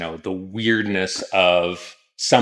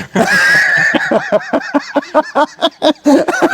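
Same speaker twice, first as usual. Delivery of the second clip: laughing; 3 dB louder than usual. Real, not acted. Embarrassed laugh after Instagram remark.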